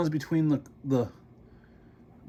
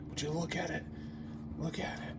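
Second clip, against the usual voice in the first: whisper; 2 semitones higher, 15 dB quieter than usual. Real, not acted. Showing disgusting dust to viewers.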